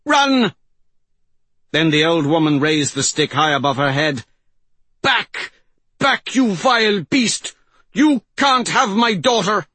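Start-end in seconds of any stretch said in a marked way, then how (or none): 0.1-0.6 high, loud shouted warning. A narrator's reading. Old woman shouting at the mystic to flee, loud shout. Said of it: "shouted at the mystic". 5.0-9.7 high, defiant battle cry; fierce shout. A narrator's reading. Old woman driving bear from her daughter, fierce shout. Mother fends off bear with staff.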